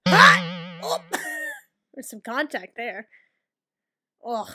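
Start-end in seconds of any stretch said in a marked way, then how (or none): 0.1-0.8 loud short cry; sudden burst of alarm; startled yell. Real, not acted. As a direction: yelp at a mishap. Startled during play.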